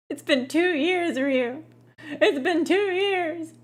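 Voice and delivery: high, drawn-out mock wail; mock lament. Streamer protesting to a chatter about two years, mock wailing. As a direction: stretch it out in exaggerated protest.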